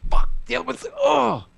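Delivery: high, strained cry of despair; exasperated outburst. Retold or imitated. Sleepless parent as the other child wakes, exasperated outburst. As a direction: wail it like a parent at wits' end.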